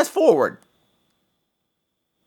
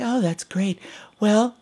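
whispered reply; 5 semitones higher, slower than usual. Retold or imitated. Wife humoring husband's ghost story.

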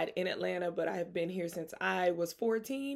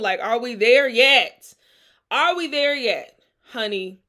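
impatient exclamation; 4 semitones higher, 10 dB louder than usual. Real, not acted. Impatient with life's pace.

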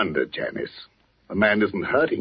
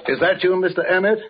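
higher-pitched questioning call; 7 semitones higher than usual. Acted. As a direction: call out to check who is there. Calls to someone in the belfry.

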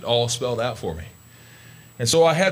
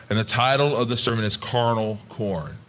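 ordinary talk

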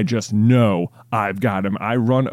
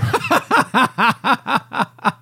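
high burst of laughter; 12 semitones higher than usual. Real, not acted. Laughs at own punchline.

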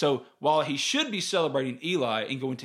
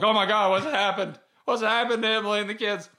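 higher and louder, frantic worry; panicked outburst; 7 semitones higher, 5 dB louder than usual. Retold or imitated. Panic attack, wife not answering, frantic loud worry. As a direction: blurt it out in rising panic.

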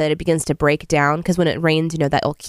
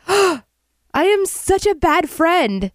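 high, slow, horrified wail; gasp, then wail it out high; dismayed friend; 12 semitones higher, much slower than usual. Retold or imitated. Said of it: "she's like".

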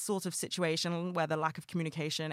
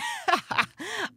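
high giddy laugh; 3 dB louder than usual. Real, not acted. Giddy after New York news.

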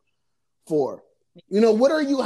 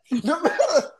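laughing; 5 semitones higher, slower than usual. Real, not acted. Laughing while repeating herself.

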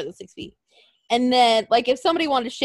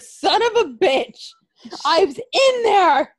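high, loud, slowed-down outraged yell; hammed-up furious wrestler shout; mock angry yell; 7 semitones higher, 6 dB louder, slower than usual. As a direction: yell it like you just got dropped in a box. Wrestler yelling after coffin dropped.

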